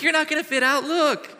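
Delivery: exasperated reply. Retold or imitated. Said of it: "I'm like".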